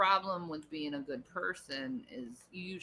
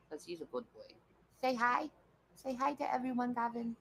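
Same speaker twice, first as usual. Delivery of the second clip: high, cooing voice for a pet; pet-talk voice; 6 semitones higher than usual. Real, not acted. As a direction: coo lovingly to your pet.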